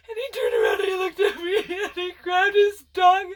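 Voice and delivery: choked-up apology to the landlord; crying while telling. Real, not acted. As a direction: push through tears and nervous laughs.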